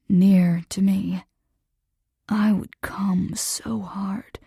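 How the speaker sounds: slow rough whisper; roughened voice. Acted. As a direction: drag it out, rough and hungry. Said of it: "his voice roughened".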